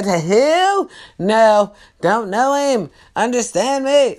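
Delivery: loud, drawn-out refusal, then firm; stern denial. Shown as a demonstration. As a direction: coach a forceful, stern denial. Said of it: "Caucasians stay stern".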